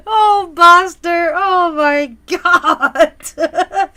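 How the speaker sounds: high breathless laugh into a slow, loud oh my God; real laughing shock; helpless laughter. Real, not acted. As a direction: laugh it out in disbelief. Reacting to a shocking comment.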